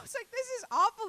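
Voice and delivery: high, loud wail of complaint; mock agony. Comic retelling the assault bike, high loud whine. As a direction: squeal it like the bike nearly killed you.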